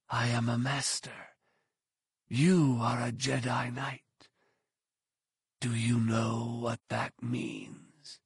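soft, slow menacing whisper. A narrator's reading. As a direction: lecture him in a hush. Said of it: "The hooded man's voice came back as a whisper".

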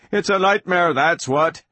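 high-pitched inner panic; panicked. Acted. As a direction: cry it out in alarm. Panicked inner voice of trapped minds.

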